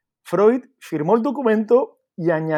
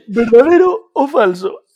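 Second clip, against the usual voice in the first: quiz host; 3 semitones higher, 7 dB louder, slower than usual. Acted. Quiz host asks, laughing.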